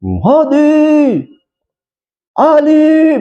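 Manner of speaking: high, called-out names; mock shouting names.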